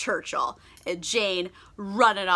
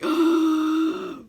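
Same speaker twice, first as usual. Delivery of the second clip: surprised gasp; 5 semitones higher than usual. Retold or imitated. Emma reacting to confession.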